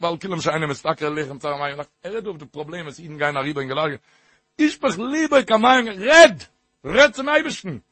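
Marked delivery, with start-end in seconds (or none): 4.6-7.8 loud, high rising cry of urging; impassioned exhortation. Real, not acted. Preacher urging listeners to talk to God, impassioned exhortation. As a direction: belt out the key word, stretched.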